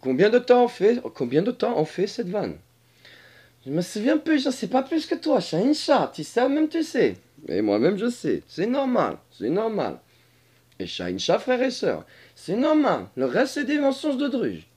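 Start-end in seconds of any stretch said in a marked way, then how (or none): 3.7-7.2 high put-on voice, protesting; high-pitched dragon arguing back; comic dragon character. Dragon figure replies. 8.5-14.7 high put-on creature voice, insisting; high-pitched dragon explaining itself; comic dragon character.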